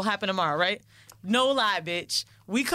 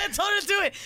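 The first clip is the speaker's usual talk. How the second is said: laughing through the line; chuckling while quoting; 11 semitones higher, faster than usual. Retold or imitated. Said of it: "he's like".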